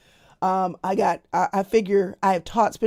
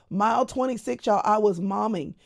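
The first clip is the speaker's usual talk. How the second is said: ordinary talk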